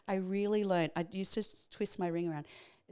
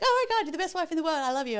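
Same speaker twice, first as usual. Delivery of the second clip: much higher, louder and fast, over the moon; gushing husband; 10 semitones higher, 7 dB louder, faster than usual. Retold or imitated. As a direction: rush the praise out, overjoyed. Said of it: "He's like".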